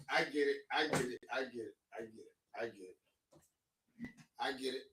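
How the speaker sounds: mocking his brother's excuse, fading to nothing; fading repetition. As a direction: repeat it, letting it die away. Repeated phrase fading away.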